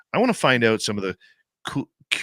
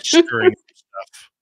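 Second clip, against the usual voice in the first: mock scolding; 7 dB louder than usual.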